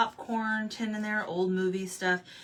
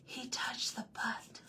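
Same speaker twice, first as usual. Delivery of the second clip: higher, slow whisper; fish gossip; 5 semitones higher, 9 dB quieter, slower than usual. Retold or imitated. Quoting Nemo's friends, high slow whisper. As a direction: whisper it like a scandalized little fish. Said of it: "Finding Nemo".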